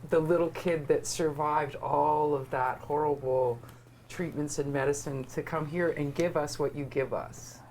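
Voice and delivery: normal talking voice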